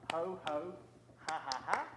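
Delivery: ordinary talk, nothing marked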